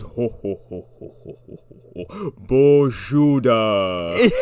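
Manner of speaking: ho ho ho fading away to a breath, then slow; trailing mock Santa laugh fading. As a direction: let the laugh die out to nothing. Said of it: "and Santa goes".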